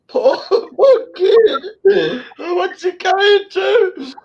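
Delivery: real tears, words barely getting out; crying. Real, not acted. Grandfather choking up over his baby grandson, crying. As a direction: voice breaking high into sobs, slow. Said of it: "I'm sorry".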